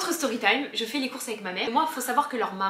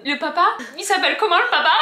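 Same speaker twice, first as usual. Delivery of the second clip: eager question; 8 semitones higher, 11 dB louder than usual. Retold or imitated. Retold question, high loud.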